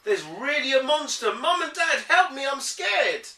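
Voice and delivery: high, loud scared plea; panicked child calling parents; real fright acted out; frightened. Kipper truly frightened.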